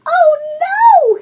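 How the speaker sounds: high, loud panicked cub; high baby bear panicking. A narrator's reading. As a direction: gasp it out in alarm. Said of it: "he panicked".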